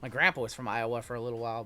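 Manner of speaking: ordinary talk, nothing marked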